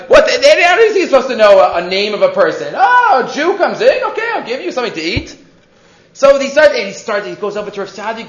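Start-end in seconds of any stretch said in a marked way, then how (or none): 2.7-5.4 casual innkeeper welcome. Retold or imitated. Imagined innkeeper greeting an unknown guest.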